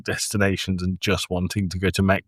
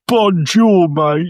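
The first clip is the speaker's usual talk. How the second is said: put-on loud, high English-accented French; hammed-up English tourist mangling French; mock a Brit's matey English-accented bonjour; 9 semitones higher, 7 dB louder, slower than usual. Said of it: "'Cause you went".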